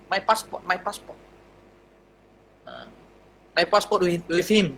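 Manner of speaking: low, soft helpless plea; timid plea. Retold or imitated. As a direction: mumble it like a lost student. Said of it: "Jadi Buya kata". (rayuan perlahan dan rendah)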